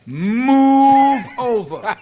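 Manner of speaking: long, drawn-out loud high holler; comic scream. Pretend. Telling a jogger how to face trampling cows, mock scream. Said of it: "and scream".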